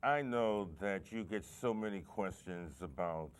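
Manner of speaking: usual speaking voice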